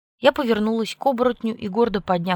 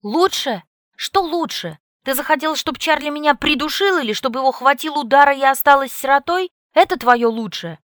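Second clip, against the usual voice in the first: loud, higher furious outburst; 7 semitones higher, 7 dB louder than usual. A narrator's reading. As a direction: throw his word back angrily. Furious retort to Jake.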